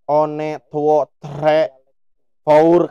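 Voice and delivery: counting one, two, three in a Javanese accent; Javanese-accented English. Shown as a demonstration. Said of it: "bukan gini kan". (hitungan Inggris dengan logat Jawa)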